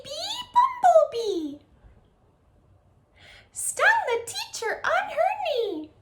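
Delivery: very high, squeaky, silly chant. Shown as a demonstration. Music teacher leading kids' echo chant in a high squeaky voice. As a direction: squeak it way up high like a cartoon mouse. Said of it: "switch into my high squeaky voice".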